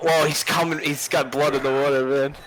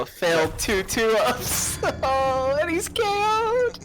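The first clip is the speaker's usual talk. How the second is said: rising excited play-by-play; excited commentator; 13 semitones higher, slower than usual. Pretend. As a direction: call it like a hyped announcer.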